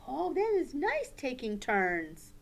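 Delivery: ordinary talk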